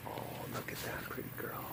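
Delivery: very soft, quick whisper; hushed so as not to startle her; adoring whisper. Real, not acted. Admiring the tiger belly in the bushes, whispering.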